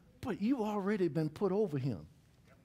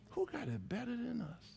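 much softer echo of the call; soft repeat; 6 semitones higher, 8 dB quieter than usual. Acted.

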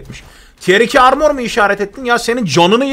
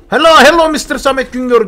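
mock Indian-accented hello; imitating the stranger; 5 semitones higher, 7 dB louder than usual. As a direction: parrot the loud stranger's greeting, louder. (yabancıyı taklit ederek selam)

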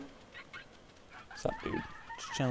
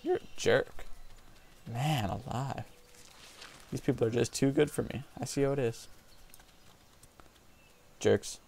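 usual speaking voice

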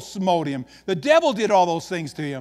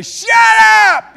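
full-out high yell; yelling out the window; 15 dB louder than usual. Acted. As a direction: holler it at noisy animals outside. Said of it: "open your window and say".

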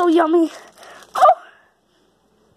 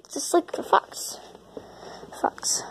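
whisper; 11 dB quieter than usual. Acted. Hushed aside.

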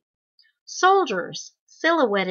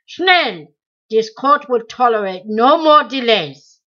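angry shout; 8 dB louder than usual. A narrator's reading. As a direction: bark it to silence the old man. Interrogator shouting at the prisoner.

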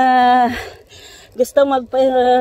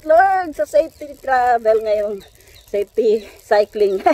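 normal talking voice

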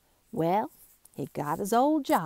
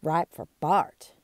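ordinary delivery, nothing marked

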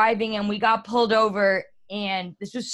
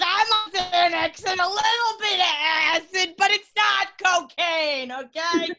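put-on high, pleading voice of a drunk girl caught by a cop; hammed-up frantic excuse, pitched up; panicked drunk friend; 7 semitones higher, 3 dB louder than usual.

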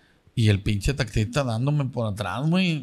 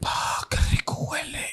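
seductive whisper; 5 dB quieter than usual. Retold or imitated. Seductive Uber driver sniffing him.

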